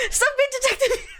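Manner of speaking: high-pitched, laughing squeal; laughing protest. Real, not acted. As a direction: protest through real laughter, voice shooting up. Streamer cracks up at game character.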